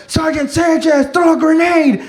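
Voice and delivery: high-pitched yelled order; imitated combat call. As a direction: call an order to a sergeant in a firefight. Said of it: "somebody calling me to throw a grenade".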